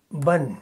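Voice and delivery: بن said as "ban", short a into n; بن pronounced ban with a crisp final n; fathatain on ba. Shown as a demonstration. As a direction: model بن as ban for the student. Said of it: "تو آواز نکلے گی".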